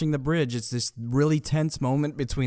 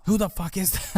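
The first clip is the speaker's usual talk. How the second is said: mock demand; 7 semitones higher than usual. Kidnappers demand who is yelling.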